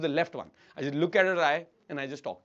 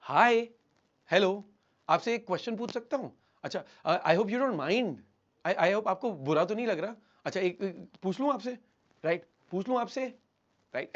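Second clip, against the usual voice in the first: "Hi, hello" with long rising endings; thin, tentative voice lifting on "हूँ" and "आपसे"; upward questioning tail. Shown as a demonstration. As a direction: make every phrase, even "Hi", an unsure question. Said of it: "ऐसे ऊपर जाती है आवाज".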